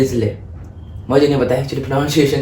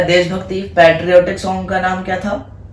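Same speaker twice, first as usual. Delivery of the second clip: ordinary talk, nothing marked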